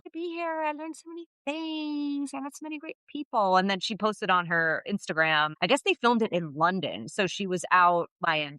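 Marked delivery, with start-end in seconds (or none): 0.0-3.6 lightly voiced [name]. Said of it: "[name]'s post-show spin".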